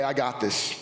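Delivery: low, soothing reassurance; calm a frightened child; reassuring dad. Retold or imitated. A father comforting his child, low and soothing.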